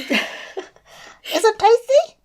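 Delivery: very high, slow cooing voice. Real, not acted. Teasing the dog licking the microphone, baby talk. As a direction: coo it high and drawn out.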